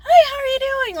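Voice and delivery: high, loud, bubbly hello; super enthusiastic greeting. Retold or imitated. Always-happy worker greeting everyone, super enthusiastic. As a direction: burst out with a happy, friendly greeting. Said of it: "super enthusiastic".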